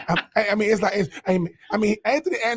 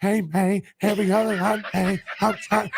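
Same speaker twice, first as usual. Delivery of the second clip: Terrence Howard impression. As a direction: imitate a movie hustler's put-on accent. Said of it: "Terrence Howard".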